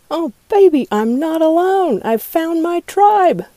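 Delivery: loud, high triumphant cry; elated exclamation. Acted. Comedian rejoicing at finding their tribe, elated. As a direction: whoop it out, stretching the last words. Mock elation at finding her people.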